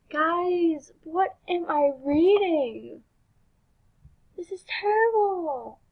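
high, drawn-out incredulous complaint; exasperated dismay. Real, not acted. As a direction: stretch the words out in disbelief. Dismayed reaction to grim game story.

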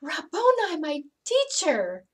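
high, loud cry of recognition; joyful shout. Acted. Mary recognizing Jesus in the garden, shouting for joy. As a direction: call out his name in sudden joy. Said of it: "I shouted".

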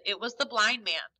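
usual speaking voice

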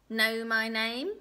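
slow, loud question, swooping up; little man's taunt. Acted. Little man quizzes the queen, taunting. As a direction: goad her, rising sharply on the end.

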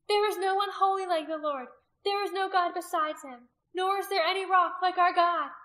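high, loud proclamation; crying out. A narrator's reading. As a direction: cry scripture over the chant. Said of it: "Rizpa cried out".

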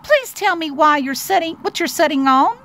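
high, eager little mouse voice; pleading little mouse. Acted. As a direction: ask it sweetly as a small mouse. Mouse begs bee for answer.

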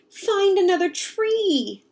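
high, slow pleading shout; pleading cry. Acted. Girl begging Papa to spare the tree, pleading cry. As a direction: beg him loudly to spare it. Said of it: "A voice splits the stillness".

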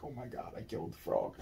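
very soft, choked-down retelling; retold dismay. Man retelling stepping on his frog friend, hushed dismay. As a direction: let the voice nearly vanish. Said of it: "I was like".

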